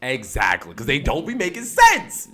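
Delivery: high, loud exclamation; emphatic agreement. Real, not acted. Co-host loudly agreeing that it makes no sense, emphatic.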